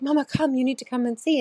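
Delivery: lightly mimicked insistent daughter; excited thirteen-year-old. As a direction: imitate a child urging mom to come. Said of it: "my thirteen-year-old comes into the room and she's like".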